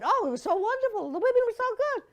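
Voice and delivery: high, gushing society lady; imitating Liz. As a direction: gush like a breathless theater lady. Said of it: "And so Liz said".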